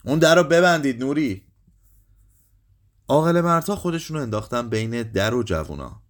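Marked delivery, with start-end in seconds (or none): 0.0-1.5 high, raised command; shouted order. A narrator's reading. Father orders Nuri to close the door, shouting. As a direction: shout to get it shut at once. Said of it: "پدر فریاد زد".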